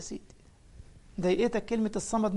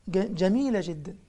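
usual speaking voice

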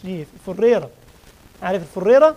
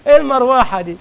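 amused outburst; 5 semitones higher, 10 dB louder than usual. Real, not acted. Teasing reply after laughing.

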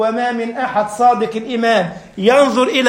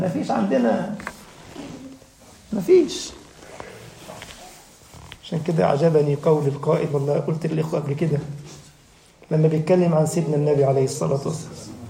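ordinary delivery

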